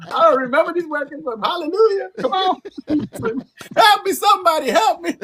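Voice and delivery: high, laughing preacher-like plea; laughing mock plea. Singer laughing at himself, high mock gospel plea. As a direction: laugh through a pretend cry for help. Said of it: "So I was just going like this".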